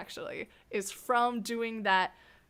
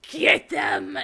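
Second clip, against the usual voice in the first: loud command at the same pitch; scare command; 10 dB louder than usual. Retold or imitated. Scare actor commanding the golem to chase guests, loud. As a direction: order your partner to chase them. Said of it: "be like".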